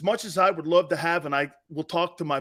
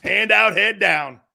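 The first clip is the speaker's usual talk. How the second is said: loud mocking payoff; loud punchline; 3 semitones higher, 6 dB louder, slower than usual. Shown as a demonstration. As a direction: Hand out punched loud, head down falling. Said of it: "That's how he went back".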